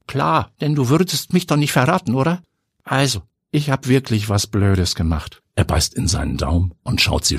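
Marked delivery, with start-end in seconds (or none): 0.1-5.4 put-on higher boyish voice, sheepish; guilty young nephew. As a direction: confess a little sheepishly. Neffe gesteht kleinlaut. (höhere, schuldbewusste Jungenstimme)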